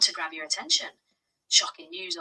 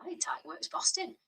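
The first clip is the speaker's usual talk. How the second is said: put-on Yorkshire accent; 3 semitones higher, 7 dB quieter than usual. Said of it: "Yorkshire accent".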